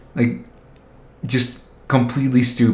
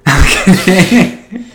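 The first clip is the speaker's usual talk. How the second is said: louder and higher, slow, laughing through it; 8 semitones higher, 8 dB louder, slower than usual. Real, not acted. Joking with a friend about bribing kids, laughing.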